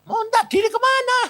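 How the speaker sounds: high, loud, rapid query; astonished question. Retold or imitated. Preacher asks the departing spirit where it is going, astonished. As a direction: fire it back in acted alarm.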